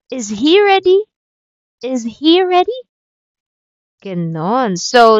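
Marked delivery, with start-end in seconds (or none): 0.1-2.8 "Is he ready?" pitched high and rising on "ready"; rising question tune. Shown as a demonstration. Teacher showing English question intonation, voice rising high. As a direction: lift the end up so it asks. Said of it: "raise your voice at the end".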